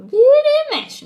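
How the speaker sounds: a sudden loud insistent cry; loud emphatic. Shown as a demonstration. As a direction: burst out with "Vira e mexe". Repeats phrase, second louder.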